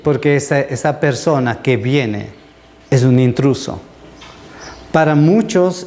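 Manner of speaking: normal talking voice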